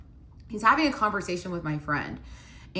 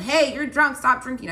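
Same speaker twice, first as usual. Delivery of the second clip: raised, high scolding call; mock yell; 7 semitones higher, 7 dB louder than usual. Woman showing partner what she didn't yell, mock yell. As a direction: imitate yelling a public telling-off. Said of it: "It's not like I yelled".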